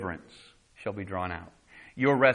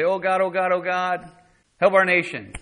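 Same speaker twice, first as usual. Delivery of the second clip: higher, louder pleading to God; mock fervent prayer; 6 semitones higher, 7 dB louder than usual. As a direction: cry out a showy prayer. Acting out prayer while fasting.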